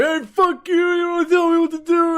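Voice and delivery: high, loud mock screaming; yelling obscenities. Imitating the cyclist cursing him out, high-pitched yelling. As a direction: imitate a furious cursing yell, voice way up. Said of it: "just starts yelling obscenities".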